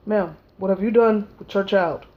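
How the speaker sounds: a hint of a low, stern officer; lightly voiced officer; stern official. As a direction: question her like a suspicious cop. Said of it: "It's looking like".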